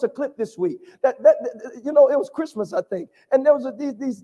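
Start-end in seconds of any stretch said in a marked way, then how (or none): none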